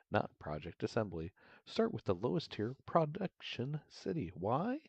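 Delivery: sweet assistant with lively pitch leaps; sugary-sweet AI voice. Acted. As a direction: lay on the helpful AI sweetness. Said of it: "that's too sugary sweet".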